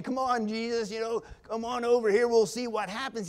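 a loose, shrugging invitation; offhand casual. Retold or imitated. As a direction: toss it off like it doesn't matter much. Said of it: "He isn't just saying".

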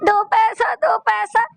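hammed-up tearful whine; mock tearful. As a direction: parody someone putting on tears. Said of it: "ਆਂਸੂ ਜਿਹੇ ਬਹਾ ਕੇ ਦਿਖਾਉਂਦੀ".

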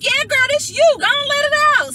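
very high, loud whooping pep talk; hammed-up high, loud answer to herself; emphatic self-encouragement. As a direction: cheer yourself on at full volume. Acts out egging herself on.

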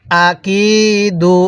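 slow "আকিদু" with a long held কি; drawn-out vowel. Shown as a demonstration. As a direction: stretch the কি in "আকিদু". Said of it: "এরকমভাবে পড়ব".

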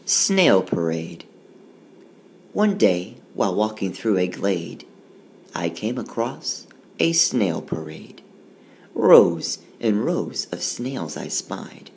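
lively, sing-song riddle read aloud; poem reading. Acted. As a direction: read it like a playful rhyme for kids. Reads rhyming poem aloud.

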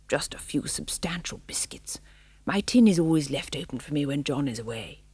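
breathy secretive whisper; spaniel's whisper. Acted. Old spaniel whispering to the guests about biscuits. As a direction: whisper it like a kindly conspirator.

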